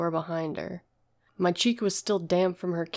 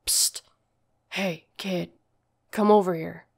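low inviting voice. Acted. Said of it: "It was a male voice, low and deep, but inviting".

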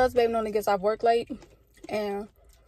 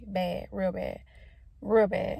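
Carisha voice; 3 semitones lower, 3 dB quieter, slower than usual. Retold or imitated. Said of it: "in Carisha voice".

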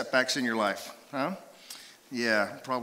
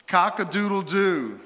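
loud, high rooster crow; 5 semitones higher, 3 dB louder, slower than usual. Shown as a demonstration. Pastor's toughest quiz sound, loud high rooster crow. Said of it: "Probably toughest one yet".

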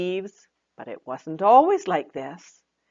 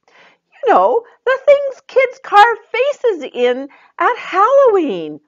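much higher, louder teasing clue; Joy hinting; 9 dB louder than usual. Acted. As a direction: tease out the answer. Joy gives a hint.

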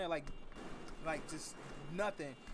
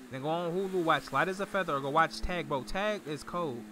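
loud; 9 dB louder than usual. Real, not acted. Shouting show list to viewers.

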